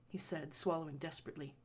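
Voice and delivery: ordinary delivery, nothing marked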